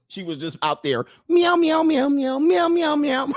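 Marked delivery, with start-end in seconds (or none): 1.3-3.3 loud, high-pitched rapid meows; comic caterwauling imitation; frantic cat meowing. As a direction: yowl like a cat in the act. Imitating cats mating in the alley.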